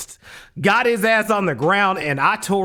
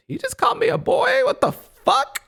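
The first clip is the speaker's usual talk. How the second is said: stunned disbelief. Retold or imitated. Inner reaction to being called boy.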